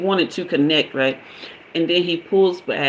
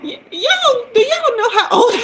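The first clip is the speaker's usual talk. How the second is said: squealed much higher through laughter; high laughing squeal; 4 dB louder than usual. Real, not acted. Asking the audience about her age, high laughing squeal. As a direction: shriek it up high, giggling at yourself.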